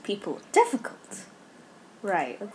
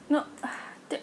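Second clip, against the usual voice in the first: ordinary talk, nothing marked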